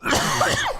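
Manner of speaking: hard hacking cough; coughing fit. Real, not acted. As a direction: cough between phrases. Coughing fit during the monologue.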